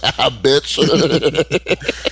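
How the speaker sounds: imitated cackling reaction, high and loud. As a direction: crack up through the words. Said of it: "[name] was cackling".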